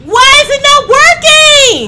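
very high, very loud desperate yell; frantic yell. Real, not acted. Player panicking as the flashlight fails, frantic yell.

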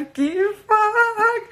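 long drawn-out high kifak; greeting the kitchen designer on the call, drawn out and thrilled; excited greeting. Real, not acted.

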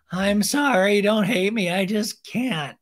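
loud, high, hammed-up plea; mock apology. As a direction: beg forgiveness in a put-on high voice.

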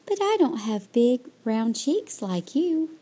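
ordinary delivery, nothing marked

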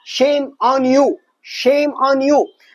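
very slow, every word drawn out; slow drill. Shown as a demonstration. Teacher drilling a phrase for students, very slow. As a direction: spell out each word slowly for the learner. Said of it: "احفظها بالتكرار".

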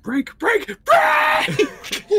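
radio distress shout. Acted.

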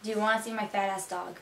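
quick, soft Miami-accented sentence. Shown as a demonstration. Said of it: "Now for some sentences for you guys".

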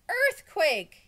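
high, loud alarmed yell. A narrator's reading. Boy yells as the building shakes, high and loud. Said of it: "yelled Stephen".

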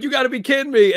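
high, loud disbelief; you gotta be kidding, high and loud; incredulous outcry. Retold or imitated. Fans crying out in disbelief, high and loud. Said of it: "everyone was like".

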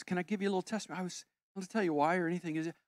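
normal talking voice

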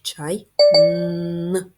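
teacher segmenting чайник aloud; sounding out. Shown as a demonstration. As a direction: break the word off and hit the н. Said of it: "Наступний звук".

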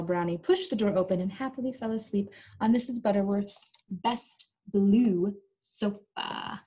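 usual speaking voice

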